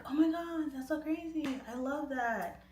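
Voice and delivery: high-pitched fangirl enthusiasm; starstruck gushing. Retold or imitated. Fan answering [name]'s birthday message, high starstruck gushing. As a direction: gush airily at a celebrity.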